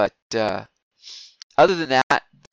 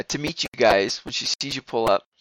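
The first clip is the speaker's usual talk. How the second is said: usual speaking voice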